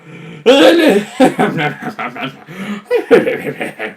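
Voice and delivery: long, high manic giggle; babbling crazed cackle; mock mad laughter. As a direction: laugh as if you've cracked. Acting driven mad by fish.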